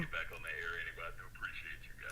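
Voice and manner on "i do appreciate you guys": sexy voice. Acted. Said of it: "see, that's that sexy".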